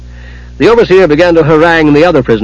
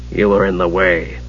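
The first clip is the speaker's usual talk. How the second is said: low, quiet, curt mage; curt retort; 6 semitones lower, 6 dB quieter than usual. A narrator's reading. Raistlin answering the dazed kender, curt. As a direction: answer shortly, low and soft. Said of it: "Raistlin returned shortly".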